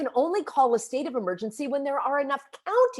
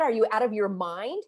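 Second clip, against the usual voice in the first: ordinary delivery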